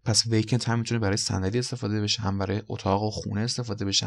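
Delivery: ordinary talk, nothing marked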